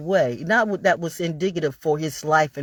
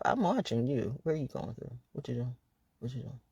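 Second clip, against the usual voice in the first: near-whispered taunt, much softer; hushed watching; 5 semitones lower, 11 dB quieter than usual. Pretend. Taunting the viewer, soft and hushed. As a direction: lean in and murmur, i'm watching you. Mock surveillance whisper to camera.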